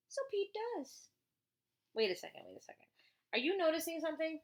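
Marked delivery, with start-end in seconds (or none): none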